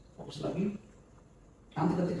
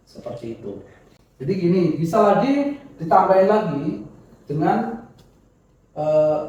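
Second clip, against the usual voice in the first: ordinary delivery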